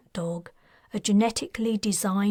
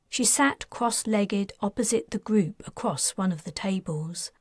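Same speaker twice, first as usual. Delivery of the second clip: ordinary delivery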